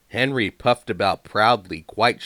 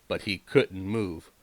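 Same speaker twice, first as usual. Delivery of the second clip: plain reading voice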